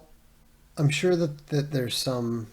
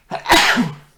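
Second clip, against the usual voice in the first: loud sudden sneeze; 12 semitones higher, 16 dB louder than usual. Real, not acted. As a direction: let out a sharp sneeze. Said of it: "I'm gonna sneeze".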